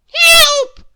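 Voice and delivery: very high, loud shriek. A narrator's reading. Magic wand being seized, shrieking for help. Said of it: "shrieked the wizard's wand in a high, shrill voice".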